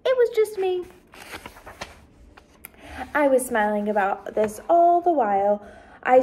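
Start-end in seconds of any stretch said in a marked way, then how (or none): 0.0-0.9 modest. A narrator's reading. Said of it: "told them".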